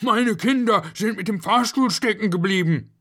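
high, loud cry of alarm; panicked father raising the alarm; urgent shout. A narrator's reading. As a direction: call frantically for the janitor's help. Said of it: "rannte zum Hausmeister und rief".

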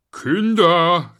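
put-on loud call to the kids. Schnuffel calls his children over, loud. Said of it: "rief Schnuffel".

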